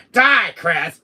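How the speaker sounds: loud, high battle yell; murderous shout. Acted. Villain shooting Chris, shouting. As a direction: shout it as you fire.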